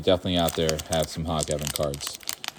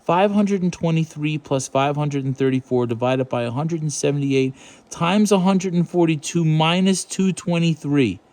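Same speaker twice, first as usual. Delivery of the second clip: incredulous read; 7 semitones higher than usual. Acted.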